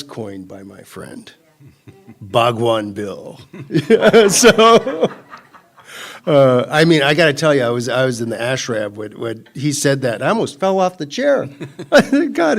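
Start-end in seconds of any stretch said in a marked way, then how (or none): 4.3-5.1 loud, high burst of laughter; real laugh. Real, not acted. Laughs at friend's joke. 10.2-12.6 laughing. Real, not acted. Recalls laughing at joke.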